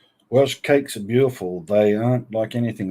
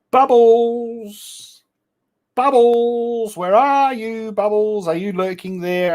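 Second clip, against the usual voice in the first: bubble cry; 12 semitones higher, slower than usual. Pretend. Streamer calling out to Bubbles in chat with a high sing-song cry. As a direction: call her name like a drawn-out summons. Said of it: "I sense the bubble cry coming".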